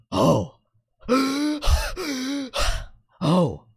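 high gasping breaths, then a small "oh"; kid's shuddery breathing. Acted. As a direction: copy a child catching their breath. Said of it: "and the kid goes".

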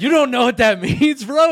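incredulous laughing tease; laughing disbelief. Retold or imitated. Teasing friend, incredulous.

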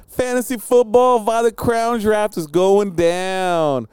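high, drawn-out event promo; playful announcer build-up; hype announcer. Pretend. Hyping the fantasy draft, announcer-like.

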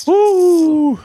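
long high-pitched woo; whoop after a shot. Real, not acted.

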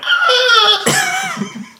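high startled yelp, mimicking Sam. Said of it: "he went".

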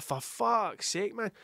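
exasperated. Acted. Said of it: "And I'm like".

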